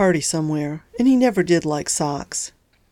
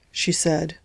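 ordinary delivery

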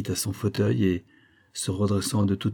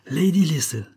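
higher-pitched indignant exclamation; indignant cry; 8 semitones higher, 4 dB louder than usual. A narrator's reading. Olivia pulling her hand away, indignant cry. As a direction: throw the name back indignantly. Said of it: "s'écria-t-elle".

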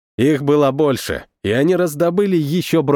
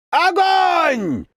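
shouted command. A narrator's reading. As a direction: yell the order to fire. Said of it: "услышал я команду сержанта".

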